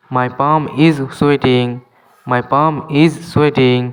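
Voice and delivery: slow, clear English for learners; careful dictation pace; slow teaching demo. Shown as a demonstration. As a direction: spell each word out slowly for students. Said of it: "এখানে এলের উচ্চারণ হয় না".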